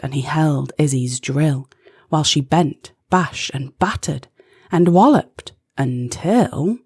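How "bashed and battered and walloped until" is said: usual speaking voice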